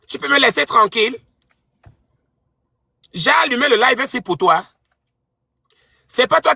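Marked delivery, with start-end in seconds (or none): none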